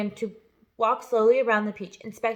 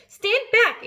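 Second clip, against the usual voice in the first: high, loud warning shout; 12 semitones higher, 7 dB louder than usual. A narrator's reading. Aunt shouts at the others to stand back, high and loud. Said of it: "Aunt Sponge shouted".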